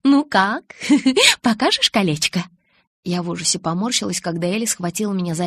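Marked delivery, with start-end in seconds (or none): 0.0-2.4 high, giggly question; playful laugh between the words; silvery laughing. A narrator's reading. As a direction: giggle and ask eagerly. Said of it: "засмеялась серебристым смехом".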